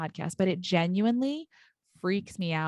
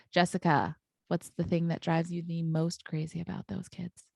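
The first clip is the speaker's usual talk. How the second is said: normal talking voice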